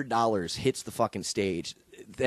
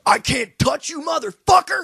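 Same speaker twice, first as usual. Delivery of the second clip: shouted much higher and louder, voice cracking; 7 dB louder than usual. Retold or imitated. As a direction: scream it like a raging attacker whose voice breaks. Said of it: "The voice, the voice still cracks".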